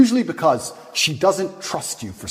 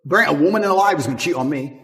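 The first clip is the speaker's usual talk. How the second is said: normal talking voice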